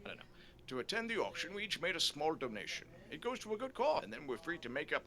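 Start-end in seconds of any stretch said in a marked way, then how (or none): 0.0-0.2 whispered, fast self-muttering; muttered aside. Real, not acted. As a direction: mutter it under your breath. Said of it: "I can't remember".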